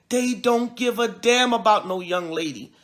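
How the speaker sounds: usual speaking voice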